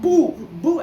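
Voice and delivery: high, loud ghostly boo; spooky booing. Retold or imitated. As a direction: scare them like a sun mask. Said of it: "a big sun mask going".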